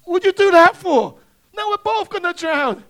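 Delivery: loud, high-pitched acted yell; outraged protest. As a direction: cry out as the betrayed, drowning frog. Stung frog protests to scorpion.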